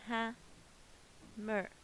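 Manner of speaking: "Ha, mer" as two separate beats; split into syllables. Shown as a demonstration. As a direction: break it apart: "Ha", long pause, "mer". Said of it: "I can hear two vowel sounds here".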